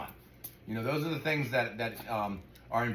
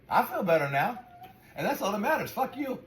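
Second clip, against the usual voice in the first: rising angry rant ending in a high snap; venting, getting hotter to the last curse; ranting outburst; 8 semitones higher than usual. Real, not acted. Vlogger ranting to viewers about the repairmen, angry outburst. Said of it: "Okay, done ranting".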